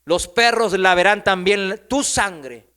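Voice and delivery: loud, slow prophetic declamation; full-force preaching. Acted. Prophet Elías delivers God's sentence to the king, declaimed loudly. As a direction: thunder the verdict out slowly.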